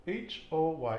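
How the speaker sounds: ordinary talk, nothing marked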